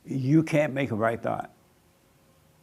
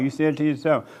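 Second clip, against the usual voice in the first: ordinary talk, nothing marked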